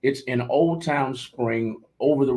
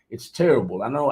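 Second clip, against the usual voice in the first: ordinary talk, nothing marked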